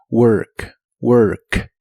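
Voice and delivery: "work" with the tongue held back on a dark r; "work" con la lengua retraída; retracted tongue demo. Shown as a demonstration. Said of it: "Mantén tu lengua retraída hacia atrás".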